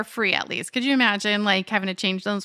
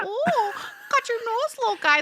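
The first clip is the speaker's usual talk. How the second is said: put-on teasing baby talk; 12 semitones higher than usual.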